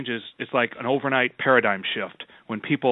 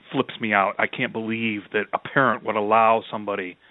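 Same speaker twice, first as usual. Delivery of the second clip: ordinary talk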